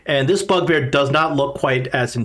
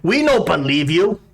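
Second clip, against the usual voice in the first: higher-pitched goblin voice; distrustful goblin; 4 semitones higher than usual. Acted. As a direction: push back suspiciously as a goblin.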